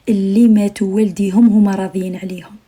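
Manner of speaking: normal talking voice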